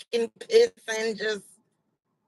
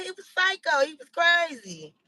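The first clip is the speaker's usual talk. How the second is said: ordinary talk, nothing marked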